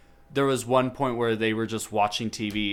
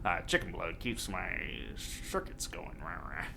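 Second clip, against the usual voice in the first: lower, much softer mumble fading into breath; cartoon Dick Cheney; 5 semitones lower, 9 dB quieter than usual. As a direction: murmur it like a creepy cartoon ghoul, trailing off. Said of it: "that's how he talks".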